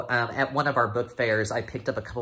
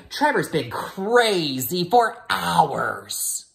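slow, louder put-on pug voice, higher; fibbing pug voice; 8 semitones higher, 7 dB louder, much slower than usual. Pug fibbing about Trevor, slow sly voice. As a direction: drag out the lie like a sly little dog. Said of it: "And then he'd say".

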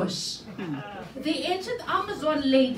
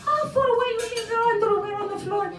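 fast, higher mock nag; wife scolding her husband, fast and higher; rapid nagging wife; 6 semitones higher, much faster than usual. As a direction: rattle it off like a fed-up wife. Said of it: "He closed one ear".

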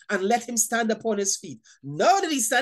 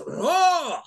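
a growled lion roar, hammed up; mock roar; 7 semitones higher than usual. Preacher mocks the devil's roar, lion roar. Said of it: "He roars".

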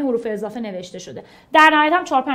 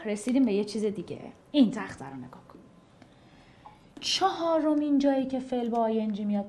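ordinary talk, nothing marked

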